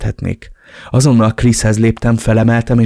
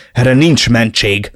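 upset outburst; 2 semitones higher, 5 dB louder, slower than usual. Acted. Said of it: "ugrottam talpra feldúltan".

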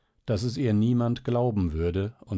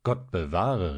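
understated, prompt refusal; quick exclamation. A narrator's reading. Doctor refusing the child's request, mild quick exclamation. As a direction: forbid it at once, mildly. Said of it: "rief da rasch der Arzt".

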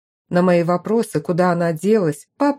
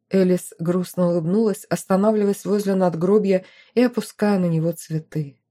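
ordinary delivery

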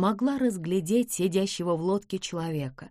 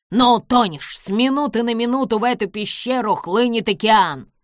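loud, higher threat of doom; menacing warning; 3 semitones higher, 6 dB louder than usual. A narrator's reading. Villain warns his rival of the tide, menacing. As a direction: hurl the warning at the foe.

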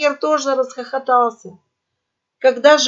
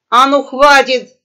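loud, drawn-out command; angry yell; 3 semitones higher, 9 dB louder than usual. A narrator's reading. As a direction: yell to cut everyone off. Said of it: "заорал Хрякер". (сердитый окрик)

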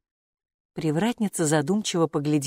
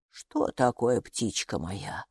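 low, softer, slowing worry; more alarmed; 9 semitones lower, 6 dB quieter, much slower than usual. A narrator's reading. Gatekeeper sees Майки freeze, low understated worry. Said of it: "ещё больше встревожилась привратница".

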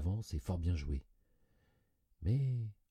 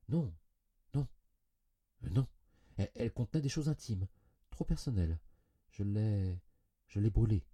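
halting, higher-pitched denials; faltering lie; 5 semitones higher, 3 dB louder than usual. A narrator's reading. Cousin lying about her husband's letter, faltering. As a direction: stumble through an excuse, searching for it.

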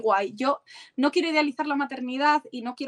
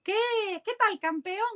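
higher, lightly voiced chummy greeting to a small boy; imitating a shopper; 9 semitones higher than usual. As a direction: mimic a stranger cheering a kid at the supermarket. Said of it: "vamos al súper y dice". (imitación suave de un desconocido que saluda a un niño)

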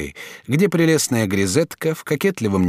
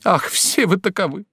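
mock tearful lament; 8 semitones higher than usual. A narrator's reading. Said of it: "призывая на помощь слёзы".

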